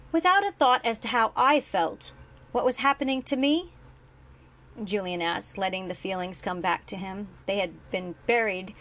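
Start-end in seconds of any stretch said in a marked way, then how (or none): 0.1-3.7 higher, louder accusing question; anger surfacing. A narrator's reading. Julian to his father, angry and loud. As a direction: hurl the question back at him. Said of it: "The anger surfaced first".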